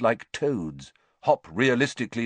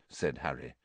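usual speaking voice

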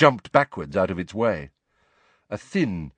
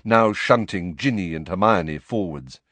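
plain reading voice

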